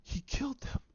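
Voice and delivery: breathless whisper of shock; horrified whisper. Retold or imitated. Finn sees Anakin striking down the Jedi, horrified whisper. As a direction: whisper it, frozen in horror. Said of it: "He's going".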